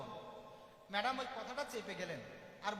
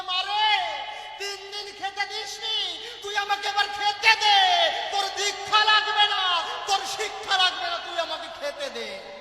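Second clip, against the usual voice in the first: put-on loud, high pleading wail of a hungry child; child's shout; 8 semitones higher, 16 dB louder, slower than usual. Hungry child Harichand shouting at his mother for food. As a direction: cry out for food, slowing right down on খেতে দে.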